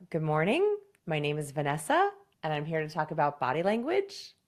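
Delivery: "morning", "Vanessa" and "language" each rising like a question; question inflection. Shown as a demonstration. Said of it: "using an accidental question inflection".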